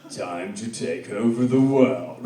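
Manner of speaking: low, slow villain reveal; sinister villain. Acted. Band plotting phase two, sinister villain voice. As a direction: drop low and draw it out ominously.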